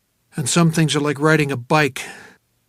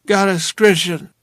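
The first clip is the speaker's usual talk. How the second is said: put-on a touch of slurred drunkenness; 3 semitones higher, 3 dB louder than usual. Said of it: "she said".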